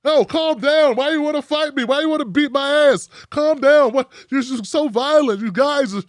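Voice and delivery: hammed-up clueless guy protesting he's so sensitive; mock innocent shout.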